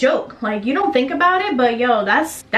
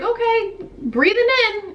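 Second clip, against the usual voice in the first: imitating herself; 10 semitones higher than usual. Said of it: "So I was like".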